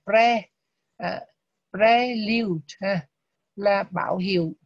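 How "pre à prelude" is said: prelude very slow and loud, stress on "PRE"; teacher demo of prelude, first syllable stressed. Shown as a demonstration. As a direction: stretch prelude out, punching the PRE. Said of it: "mà nó nhận chữ đầu này".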